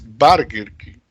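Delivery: "Burger" said as barger, English style; "Burger King" with an American vowel. Shown as a demonstration. Teacher giving the American "Burger King". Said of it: "en cualquier otro país de habla inglesa es".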